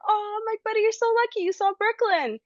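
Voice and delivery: high-pitched retold gush to a little boy; excited gushing. Mother telling her son he is lucky, excited gushing. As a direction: gush as if thrilled for him. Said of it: "in my head I was saying all this".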